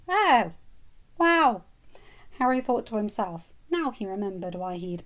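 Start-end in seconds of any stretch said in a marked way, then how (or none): 0.1-1.6 high, amazed; awed disbelief; stunned. Acted. Harry stunned by her rage.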